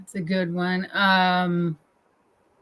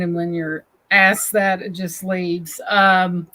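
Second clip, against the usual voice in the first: normal talking voice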